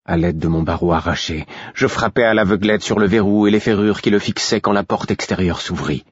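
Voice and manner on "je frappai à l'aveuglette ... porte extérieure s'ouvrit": usual speaking voice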